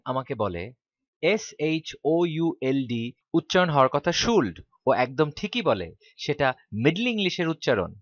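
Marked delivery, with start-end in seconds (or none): none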